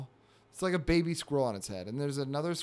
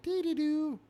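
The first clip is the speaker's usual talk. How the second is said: high little sing-song babble; carefree cartoon critter, pitched way up; happy baby squirrel. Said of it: "baby's all happy and smiling".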